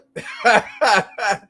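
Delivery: cracking up at his own innuendo; laughing. Real, not acted. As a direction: burst of real, helpless laughter.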